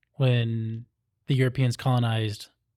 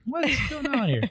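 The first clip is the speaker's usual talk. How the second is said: mock shocked son; 13 semitones higher than usual. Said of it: "gonna send it to your son".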